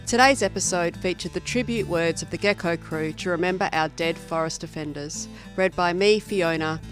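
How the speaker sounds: ordinary delivery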